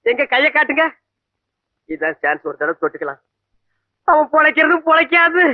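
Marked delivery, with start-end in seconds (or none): 1.9-3.2 low, soft scheming aside; hushed, much lower secret thought; sly aside. Acted. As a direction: murmur it to yourself, low and sly. Sly aside before touching hand.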